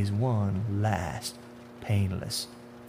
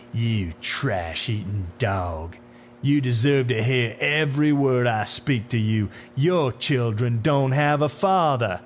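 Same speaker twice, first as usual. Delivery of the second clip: unhinged tirade; 2 semitones higher, 5 dB louder than usual. Acted. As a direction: berate him with drawn-out contempt. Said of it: "Something came unhinged in the man".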